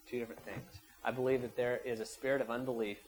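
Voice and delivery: normal talking voice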